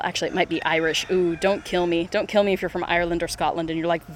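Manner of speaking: ordinary delivery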